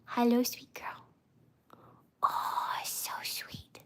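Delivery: hushed whisper to the dog; whispered cooing at her dog. Real, not acted. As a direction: whisper sweetly, barely voiced.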